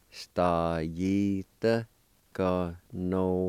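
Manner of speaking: Stajita and ganolska said slowly and apart; slow split model of Stajita ganolska; slow teaching pronunciation. Shown as a demonstration. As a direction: give each word of Stajita ganolska on its own, slowly. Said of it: "First part is pronounced like this".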